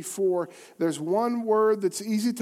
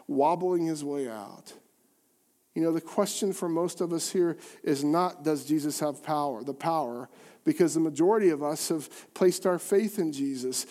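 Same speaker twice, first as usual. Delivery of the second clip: normal talking voice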